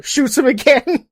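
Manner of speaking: ordinary talk, nothing marked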